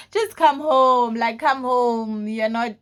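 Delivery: exasperated urging. Retold or imitated. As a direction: plead slowly and loudly down the phone. Imitates mom urging her home.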